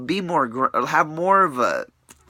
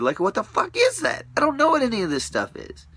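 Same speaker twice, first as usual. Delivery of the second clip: shrill, high-pitched what-the-heck; incredulous. Real, not acted. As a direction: voice leaps high in disbelief. Incredulous outburst, pitch jumps high.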